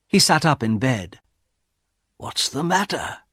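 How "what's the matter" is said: soft, raised-pitch wondering; inward thought. A narrator's reading. Husband wonders silently about his wife, soft inward thought. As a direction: think it to yourself, puzzled and hushed. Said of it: "he thought".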